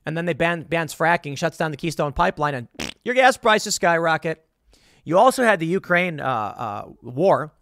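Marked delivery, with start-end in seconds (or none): none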